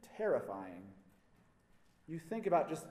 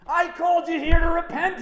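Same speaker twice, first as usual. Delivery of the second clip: much higher and much louder, a bellowed declaration; booming proclamation; 13 semitones higher, 16 dB louder than usual. Acted. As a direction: thunder it at the crowd. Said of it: "he says".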